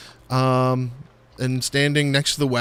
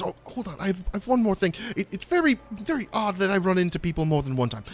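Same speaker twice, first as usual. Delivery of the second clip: higher fussy halfling voice; Stefan's voice; 5 semitones higher, 4 dB quieter, faster than usual. Acted. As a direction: remember suddenly, high and quick. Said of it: "he says".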